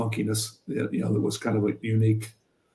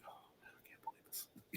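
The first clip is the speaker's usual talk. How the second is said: a hushed, whispered groan of disbelief; 34 dB quieter than usual. Retold or imitated. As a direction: whisper it like the coach muttering to himself. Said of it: "in the middle of form, he just went".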